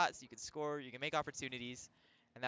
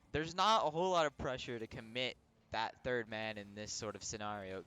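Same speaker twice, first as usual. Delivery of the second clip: ordinary delivery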